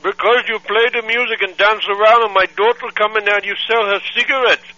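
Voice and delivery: put-on accented angry father on the phone; angry foreign dad. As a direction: scold like an outraged immigrant dad. Prank caller's put-on voice.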